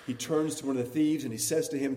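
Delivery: normal talking voice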